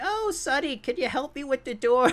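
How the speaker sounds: high-pitched put-on old lady voice; hammed-up elderly woman. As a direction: ask sweetly in a high little old lady voice. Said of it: "help this old woman".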